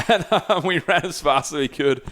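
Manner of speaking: laughing through the words; can't hold back the laughter; recalling running from the bees, laughing. Real, not acted.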